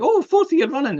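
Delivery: high, loud-ish awards gush; hammed-up delighted announcement; mock excitement. Acted cheer at awards.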